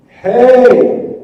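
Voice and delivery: mock booming God calling out. God voiced loudly, mock.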